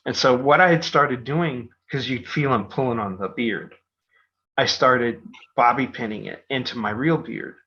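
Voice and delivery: usual speaking voice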